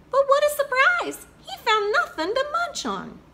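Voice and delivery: reading aloud, wide-eyed shock at the empty cupboard; surprised. A narrator's reading. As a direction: gasp out the twist to the kids. Storyteller reveals empty cupboard.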